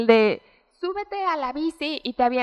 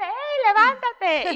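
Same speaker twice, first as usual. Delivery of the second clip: high, cheerful call to get up; imitating a carefree dad shouting encouragement; mock dad voice; 10 semitones higher, slower than usual. Voicing dad after child falls.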